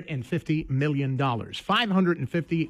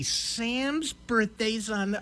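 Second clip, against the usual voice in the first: very slow mock deliberation; drawn-out pondering; 3 semitones higher, 3 dB quieter, much slower than usual. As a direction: stretch it out as if working it out. Wife still slowly picking, retold.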